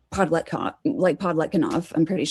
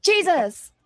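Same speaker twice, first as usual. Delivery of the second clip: startled exclamation; 11 semitones higher than usual. Real, not acted. Sudden exclamation.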